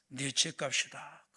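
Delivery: low, slow whisper; God's hushed answer. Acted. God answers the aching old believer, in a slow low whisper. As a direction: let it fall slow and hushed.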